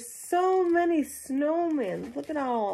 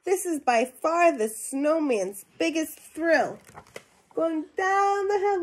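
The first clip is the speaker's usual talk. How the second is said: lively storybook reading to a class; animated read-aloud voice for young listeners; reading to children; 5 semitones higher, 4 dB louder, slower than usual. Acted. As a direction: read it out like a picture book for kids. Teacher reads picture book aloud.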